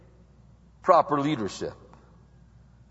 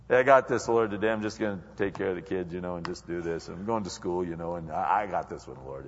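low, fast and casual, trailing softer; carefree self-reliant believer; 11 semitones lower, 5 dB quieter, faster than usual. Retold or imitated. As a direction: rattle off the day's plans, sure of yourself. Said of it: "you're probably going".

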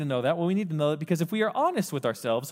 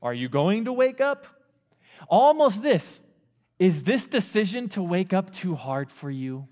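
sarcastic paraphrase; 6 semitones higher, 3 dB louder than usual. Retold or imitated. The Bible taunting the sluggard, high and sarcastic. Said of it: "if we were to paraphrase this verse".

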